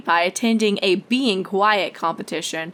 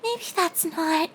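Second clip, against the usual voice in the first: timid soft; 8 semitones higher, 5 dB quieter than usual. A narrator's reading. Fluttershy timid voice.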